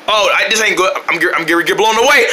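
ordinary talk, nothing marked

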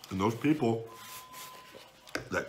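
chewing while talking; talking through a napkin; mouth full of food. Real, not acted. Seen 0: takes pasta bite at -2; cheeks full, chews, napkin over mouth.

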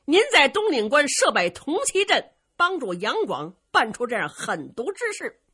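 put-on proud hero laying out his trick to the old prince; storyteller playing a bold young warrior; Luo Cheng declaiming. Storyteller voices Luo Cheng's speech.

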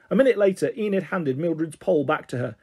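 ordinary delivery, nothing marked

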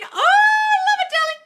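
very high, slow, loud squeal of delight. Real, not acted. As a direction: shriek with joy. Viewer manifested queen text.

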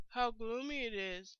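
ordinary delivery

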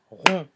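ronde cut in two, ron-de; split into syllables. Shown as a demonstration. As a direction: sound the final e of ronde as its own beat. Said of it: "deux syllabes". (ronde découpé en deux syllabes, ron-de)

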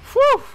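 short, high, loud "whoo"; startled whoop. Real, not acted. Player reacting to a scary sound in game, high whoop.

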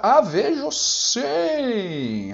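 high, long drawn-out vowels; theatrical exclamation. Pretend. Officer spotting the adversary, theatrical drawn-out cry. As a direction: stretch it out in mock grandeur.

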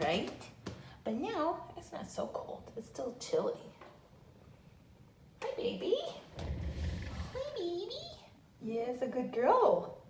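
high, soft cooing pet voice. Real, not acted. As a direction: coo sweetly to a puppy. Cooing to her dog Goldie.

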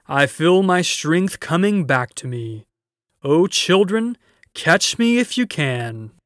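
loud, high-pitched, triumphant lion; exultant shout. A narrator's reading. Lion challenging the children to a chase, exultant shout. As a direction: shout playfully with surging strength.

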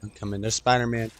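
ordinary delivery

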